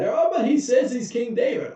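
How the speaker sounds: high, eager plea; pleading courtier. Retold or imitated.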